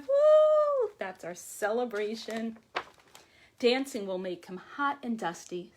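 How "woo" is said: high loud whoop; shout. Real, not acted. Teacher cheers with the children after counting. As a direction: let out a big celebratory whoop.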